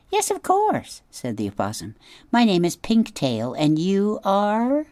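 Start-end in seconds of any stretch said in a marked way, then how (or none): 0.1-1.0 high squeaky little opossum; much higher, small timid animal voice. Acted. Opossum answering the robot in a high squeaky voice. 2.3-4.9 high squeaky little opossum; much higher, small timid animal voice. Acted. Opossum answering the robot in a high squeaky voice.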